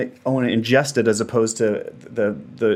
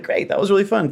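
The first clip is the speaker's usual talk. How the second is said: cheerful relief; 10 semitones higher than usual. Retold or imitated. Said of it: "I was like".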